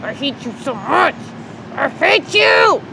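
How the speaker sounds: anger building to a high, drawn-out yell; mad outburst. Real, not acted. Yelling at the noisy trash pickup, mad. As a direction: start irritated, erupt into a high-pitched scream. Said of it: "You're so mad".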